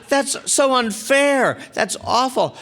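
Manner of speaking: high, slow indignant wail; mock outrage. Woman hearing he crashed while sober, mock outrage. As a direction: draw out the unfairness in high mock dismay.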